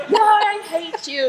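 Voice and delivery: loud, high, slow, half-laughing; retold furious cry with a laugh; angry outburst. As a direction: hurl it at God in anger.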